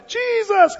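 put-on very high eager kid shout; imitating a Sunday school kid, high-pitched; little kid answering. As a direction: sing out the answer like a child. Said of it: "you're a little kid and they ask".

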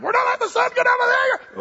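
very high, loud, fast squeaky mock voice; mock stubborn spouse. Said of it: "you're like".

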